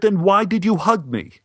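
bewildered stranger demanding answers from a hugger; baffled high outburst. Retold or imitated. Man baffled, head turning red.

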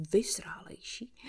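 whispered, disbelieving question; incredulous echo. Acted. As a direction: repeat the word as if it can't be true. Daughter echoes mother's word.

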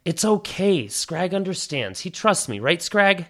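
put-on reassuring girlfriend. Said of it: "Lena's like".

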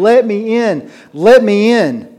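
high, loud pleading at a locked door; desperate screaming plea. Pretend. Sinner shut out, pounding and crying at heaven's door. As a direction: beg to be let in, crying out. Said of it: "standing there screaming and crying".

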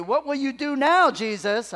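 demanding crowd. Pretend. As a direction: throw the dare at Jesus. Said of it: "and so they asked him".

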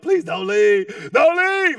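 hammed-up shouted begging, drawn out; desperate plea. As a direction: climb to a high mock wail. Bride begs groom in acted scene.

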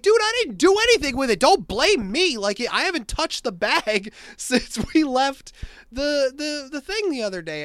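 loud, high-pitched retold outburst; indignant protest. Groom's friend denies losing the tie, loudly indignant. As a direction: protest your innocence, pitch shooting up. Defending himself against blame, retold.

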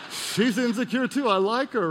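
hammed-up delight at finding her flawed; mock glee. Acting gleeful relief at another's flaw.

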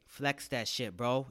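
ordinary talk, nothing marked

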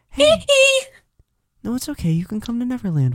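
high, squeaky pop-star falsetto. Acted. Singer inviting guests to Neverland, high falsetto. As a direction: coax in a high light voice after a squeal. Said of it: "[name] bringing everyone back".

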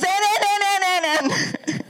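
put-on high, insistent little girl voice; imitating granddaughter. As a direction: cut in like a pushy little girl. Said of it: "she goes".

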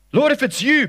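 high, loud call across the water. Acted. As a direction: Peter calling out to his Lord. Voicing Peter calling to Jesus.

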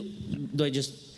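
ordinary delivery

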